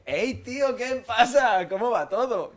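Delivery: fake casual greeting. Caught by the friend, faking a breezy hello.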